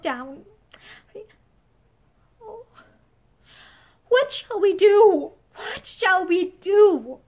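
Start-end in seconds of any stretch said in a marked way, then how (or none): 2.4-7.2 slow, loud anguished wail. A narrator's reading. Woman despairs to her lover, drawn-out anguish. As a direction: draw out each word in despair, higher at first. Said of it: "she whispered in anguish".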